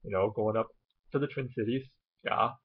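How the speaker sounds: folksy Minnesota voice, rounded O's; Minnesota accent. Shown as a demonstration. Vlogger posing as someone from Minnesota, Minnesota accent. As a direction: play a Minnesotan with a Scandinavian tinge. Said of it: "I'm from Minnesota".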